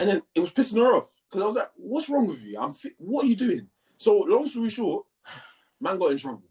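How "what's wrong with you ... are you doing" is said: high-pitched retold protest; exasperated challenge. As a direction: reenact challenging a teacher in disbelief. Retold argument with teacher.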